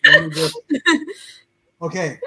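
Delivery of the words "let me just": fighting back tears, voice breaking; choked up. Real, not acted. Overcome while telling her story, voice breaking. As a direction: voice cracking high with tears. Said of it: "I'm gonna get emotional here".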